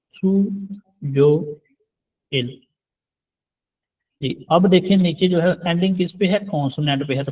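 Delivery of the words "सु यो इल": सु यो इल, each syllable said alone with pauses; syllables split apart. Shown as a demonstration. Korean teacher spelling out सु यो इल for students, syllable by syllable. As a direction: break सु यो इल into slow separate syllables. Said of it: "ये है सु यो इल".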